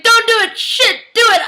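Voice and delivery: high, loud panicked shout; yelling frantically at the screen; frantic yelling. Retold or imitated. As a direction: shriek it in torn desperation. Frantic inner debate, yelled at screen.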